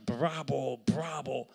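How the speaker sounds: mock ovation shout; imitated cheering crowd. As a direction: call out like an applauding audience. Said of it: "people were standing, cheering".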